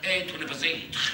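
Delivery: slow, drawn-out Belgian Hamlet; mock Belgian Hamlet. Comedian mocking Hamlet with a Belgian accent. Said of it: "Et Hamlet, il serait comme ça".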